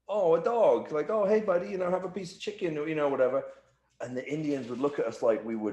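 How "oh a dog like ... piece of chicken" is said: doting on a dog. Retold or imitated. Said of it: "we're all like".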